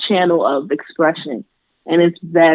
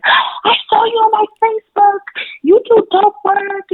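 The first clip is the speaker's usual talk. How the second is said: excited fan. Retold or imitated. Girl in Ricky's recognizes her.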